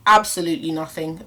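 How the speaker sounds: normal talking voice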